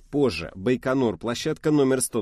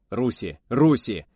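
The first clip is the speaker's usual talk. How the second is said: shouted warning, louder on the second; battle shout; 3 semitones higher, 6 dB louder, slower than usual. A narrator's reading. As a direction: yell the alarm.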